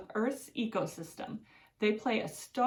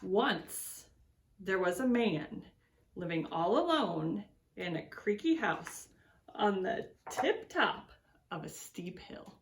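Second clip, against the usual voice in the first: put-on reading to children. A narrator's reading. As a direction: read it like story time for little ones. Reads picture book to children.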